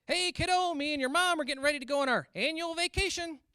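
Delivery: high, loud goofy dad; dad calling his son, loud and high. Retold or imitated. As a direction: play Dad as a cheerful oblivious caller. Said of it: "my dad called me up and he was like".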